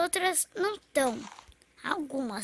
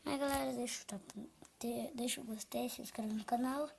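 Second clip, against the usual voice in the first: soft near-whisper, everyone asleep; sussurrando; 3 semitones lower, 10 dB quieter than usual. Real, not acted. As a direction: whisper the sign-off. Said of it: "eu tô falando baixo". (sussurrando a despedida)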